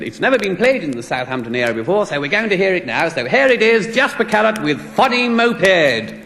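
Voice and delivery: mock DJ announcement, stretching out the title; on-air radio DJ. DJ introducing the single to listeners, hyped mock announcer voice. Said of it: "going on to the air live now".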